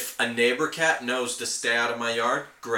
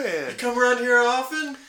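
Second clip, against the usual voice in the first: much higher, cheesy pickup line; flirty coyote; 12 semitones higher, 3 dB louder than usual. Pretend. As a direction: hams up a corny come-on. Coyote hitting on the jogger.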